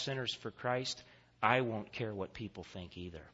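low, soft voice with long pauses; hushed solemn preaching. Real, not acted. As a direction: drop your voice and press the lesson home gravely. Hushed close of the sermon.

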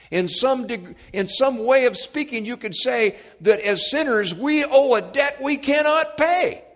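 high, loud preacher's cadence; full-force preaching. Acted. Preacher proclaiming redemption to the congregation, full-force preaching. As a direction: proclaim it to the whole church.

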